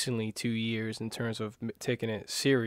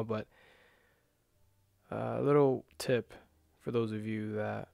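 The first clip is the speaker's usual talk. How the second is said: guided meditation. Acted. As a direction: ease them into calm breathing, gently.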